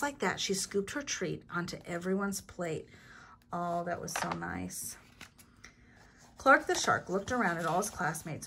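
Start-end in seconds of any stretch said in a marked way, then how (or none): none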